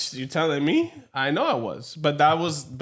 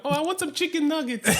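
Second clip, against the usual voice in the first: put-on higher, laughing kid voice; naive little kid; 10 semitones higher than usual. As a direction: play your clueless four-year-old self. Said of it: "being stupid".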